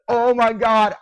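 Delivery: high, loud burst of joy; real ecstatic fan yell; excited yelling. Real, not acted. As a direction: yell it in overjoyed disbelief. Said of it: "I'm now yelling".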